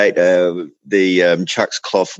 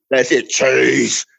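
drawn-out mock boom; booming deep voice; 4 semitones higher, slower than usual. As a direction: repeat the tough winner's answer, slowly. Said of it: "in this booming, deep voice".

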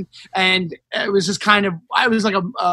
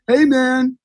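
lightly mocked drunk guy, pitched up; drunk heckler; 4 semitones higher, slower than usual. Drunk audience member approaches the comics, lightly mocked slurry drunk. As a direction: imitate a drunk guy butting in. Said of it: "some drunk asshole comes up".

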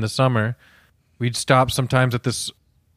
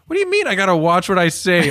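higher, fast, incredulous comeback; indignant protest; 5 semitones higher, faster than usual. Retold or imitated. Teenage nephew arguing with his cautious uncle, indignant. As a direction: throw the warning back in mock outrage.

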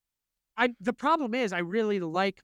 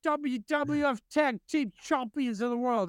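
bad Cockney; 7 semitones higher than usual. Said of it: "I can't do a Cockney".

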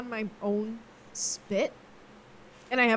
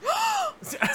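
very high sharp gasp; shocked gasp. Pretend. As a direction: gasp in mock horror. Reacting to firing joke.

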